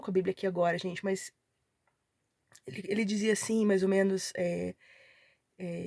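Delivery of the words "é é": ordinary delivery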